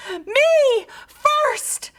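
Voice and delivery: high, loud piggy shriek. A narrator's reading. Pig in full gallop toward the voice, shrieking. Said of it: "Pinkerton shrieked".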